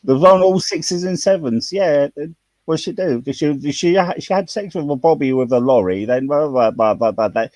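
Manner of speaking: chatty Cockney slang tale, lightly done; a touch of Cockney storytelling; Cockney accent. Shown as a demonstration. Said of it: "I'll speak a bit of Cockney".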